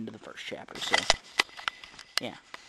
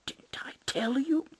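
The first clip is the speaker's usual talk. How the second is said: unvoiced, slow whisper; 11 semitones higher, slower than usual. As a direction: whisper it sideways to a friend. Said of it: "nudged me".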